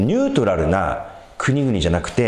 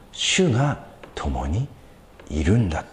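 whisper; 5 semitones lower, 3 dB quieter, slower than usual. Acted. Hushed affirmation of God's presence.

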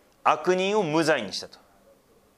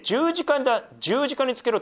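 a touch of a shouting mob, much higher; crowd crying out; 11 semitones higher than usual. A narrator's reading. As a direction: read high as a crowd's mock cry for the cross. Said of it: "しかし彼らは叫び続けて".

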